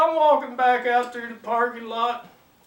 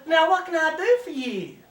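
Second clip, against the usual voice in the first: higher Southern belle voice; 6 semitones higher than usual. Acted. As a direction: play the Georgia shop girl offering help. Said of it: "good-looking Southern belle".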